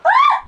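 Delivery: piercing terrified shriek; high scream. Real, not acted. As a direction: scream in pure fright. Fly in her hair.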